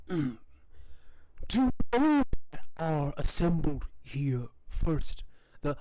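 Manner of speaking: laboured, throat-clearing ruler's voice; ailing Gustavo. Acted. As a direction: greet subjects haltingly, as if propped on a sickbed. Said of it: "and he goes".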